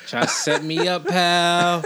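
playfully shutting down a friend; mock tough comeback. Real, not acted. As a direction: swat away the setup, cocky.

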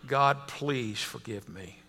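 low, slow, hushed prayer; understated tearful plea. A narrator's reading. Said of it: "why I was crying".

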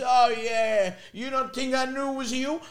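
high-pitched imitation of dad covering up; defensive dad. Son imitates dad denying he was fooled, defensive. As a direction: bluff loudly at first, pitched high. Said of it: "He goes".

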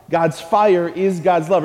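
ordinary talk, nothing marked

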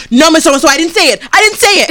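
put-on high, loud protesting child; loud, high mock kid denial; defensive kid. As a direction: whine the denial like a caught fifth grader. Kid denying, acted quarrel.

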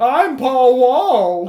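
high, loud, slow nebbish ghost; nervous ghost. Acted. Ghost introducing himself, high, loud and slow. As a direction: announce your name, timid but loud.

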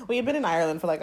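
ordinary talk

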